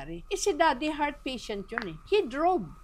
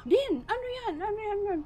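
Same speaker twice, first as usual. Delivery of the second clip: alarmed question; 11 semitones higher, faster than usual. Retold or imitated. Mother answers daughter's crying call.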